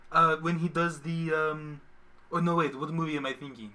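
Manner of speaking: usual speaking voice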